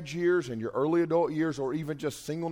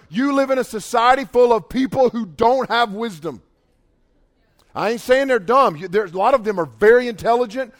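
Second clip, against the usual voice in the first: loud, urgent preacher's delivery; forceful preaching; 5 semitones higher, 6 dB louder than usual. Real, not acted. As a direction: press the point hard on the congregation. Preaches to the congregation at full force.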